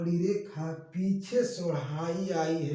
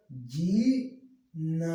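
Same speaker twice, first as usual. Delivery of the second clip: very slow, gliding syllables; like a cuckoo calling; relaxed melodious voice; much slower than usual. Shown as a demonstration. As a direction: draw out जी gently, then ना. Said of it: "आराम से सुरीली आवाज में बोलते हैं".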